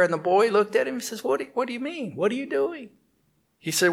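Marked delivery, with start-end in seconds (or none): 1.2-2.9 put-on higher, softer puzzled child; a hint of a curious boy; boy's voice. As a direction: ask like a curious little boy. Boy questions the monkey.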